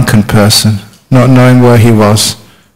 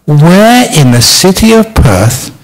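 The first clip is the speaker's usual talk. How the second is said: sad spider asking; 6 semitones higher than usual. Acted. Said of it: "the suicidal spider said".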